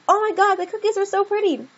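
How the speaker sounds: "Oh my God" high and gushing; exclaiming. Shown as a demonstration. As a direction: squeal it in delight. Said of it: "they're exclaiming it".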